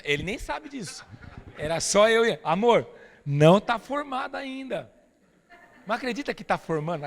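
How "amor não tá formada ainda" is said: slow, drawn-out reassurance; calm the nervous wife down slowly; reassuring. Retold or imitated. Acalmando a esposa nervosa. (marido acalmando a esposa nervosa, devagar e tranquilo)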